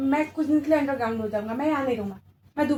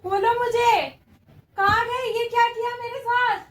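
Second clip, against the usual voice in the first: panicked plea; 7 semitones higher, slower than usual. Acted. Victim waking up tied.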